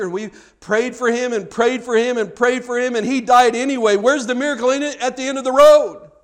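high complaint building to loud; mock protest. Somebody protesting that grandpa died anyway, mock indignant. Imagined objector's mounting protest.

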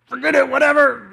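high, loud blurt; exasperated dismissal. Retold or imitated. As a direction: give up in a huff, loudly. Gives up and storms off.

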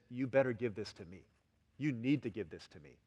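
low, quiet ultimatum; demanding. Acted. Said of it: "Demand says".

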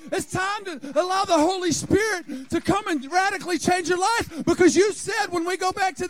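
high, loud, driving sermon shout; fiery preaching. Acted. Pastor urging the church to rise, shouting. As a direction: preach it hard and raise the roof.

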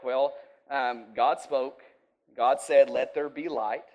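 usual speaking voice

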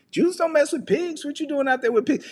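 high-voiced "what are you doing?" attitude; mock disbelief. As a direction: squeal it as a comic objection. Acts out scolding about pigs.

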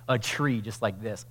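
normal talking voice

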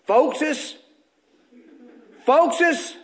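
put-on high, drawn-out call of an old worshipper; old man's call. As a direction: cry out to the folks like the old brother. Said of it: "he'd say it like this".

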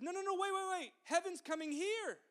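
urgently cutting someone off mid-song; urgent correction. Retold or imitated. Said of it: "John in Revelation twenty-one, twenty-two says".

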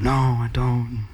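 slow, downcast retold admission; sheepish. Serviceman admitting to the preacher he has no church, sheepish. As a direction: answer slowly, as if with head hung. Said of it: "puts his head down".

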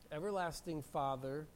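ordinary talk, nothing marked

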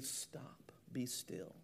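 very soft, unvoiced whisper; whispered command. Jesus showing authority over the storm, whispered command. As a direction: give the order in a calm, hushed whisper.

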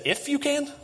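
Jesus echoing the words back high and disbelieving; incredulous echo. Retold or imitated. Jesus repeating the father's doubt back in disbelief. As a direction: pitch it up as if you can't believe the question. That's Jesus' way of saying, You kidding me?.

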